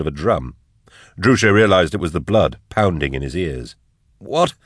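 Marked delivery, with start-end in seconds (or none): 4.2-4.5 high-pitched frantic question; urgent, high and questioning. A narrator's reading. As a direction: blurt it out in rising panic. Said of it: "he repeated frantically".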